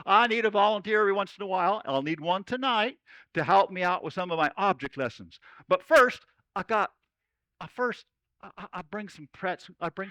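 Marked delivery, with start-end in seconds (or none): none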